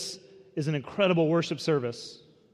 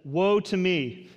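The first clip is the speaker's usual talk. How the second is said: slow lament; 4 semitones higher, 4 dB louder, much slower than usual. Acted. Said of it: "He says".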